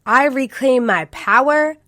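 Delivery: loud, deliberate declaration; affirmation read. Shown as a demonstration. Host closing the podcast with an affirmation, slow and loud. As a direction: proclaim it like a vow. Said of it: "So today's affirmation is".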